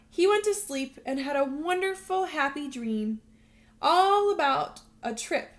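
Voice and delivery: expressive read-aloud storytelling voice; storybook narration. Acted. Teacher reading a picture book to her students, lively storytelling voice. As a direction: read it like a bedtime picture book for little kids.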